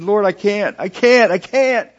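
high, loud, breaking plea; desperate cry. Retold or imitated. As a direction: cry out in anguish to God. Crying out from hospital bed.

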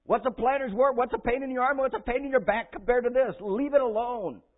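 high, full-volume scolding yell; acted-out outraged tirade, very loud; retold inner fury. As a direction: berate as if furious and embarrassed, shouting high. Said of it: "but I felt that. I felt like".

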